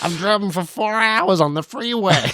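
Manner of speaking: high-pitched, hammed-up American voice; American accent. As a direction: grumble like a comic American, cracking yourself up. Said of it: "I've only got one American accent".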